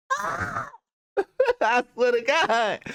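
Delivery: drawn out through laughter; real laughter, stretched; laughing. Real, not acted. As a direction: swear it while cracking up.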